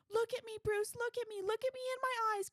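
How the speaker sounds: high, loud, hammed-up pleading; mock Betty. Podcaster mocking Betty pleading with Bruce, high and loud. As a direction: squeal it like an overeager girlfriend.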